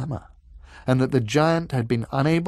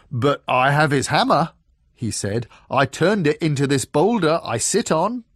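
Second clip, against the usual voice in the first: louder, proud giant voice; a big dim giant boasting; frost giant voice; 4 semitones higher, 6 dB louder than usual. Acted.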